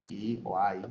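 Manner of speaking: I as Spanish "ee", then I as English "eye"; low, soft I o I, "ee" or "eye"; English letter sounds. Shown as a demonstration. Teacher showing gringo sounds, I as "ee" or "eye". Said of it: "pero tiene sonidos diferentes".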